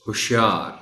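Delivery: ہوشیار as hush-yaar, short first vowel; shortened poetic form. Shown as a demonstration. Teacher repeating poetic ہوشیار, short u then long aa. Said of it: "اس کو ضرورت شعری کہتے ہیں".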